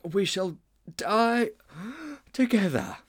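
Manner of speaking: high, broken up by breaths; winded heroic last words; exhausted gasping. Acted. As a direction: pant it out melodramatically, word by word. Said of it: "gasped Mildew".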